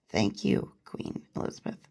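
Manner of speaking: very soft, low, fading to whisper; hushed aside. Real, not acted. Host thanking the Queen for her firmness, hushed aside. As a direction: murmur it under your breath, trailing away.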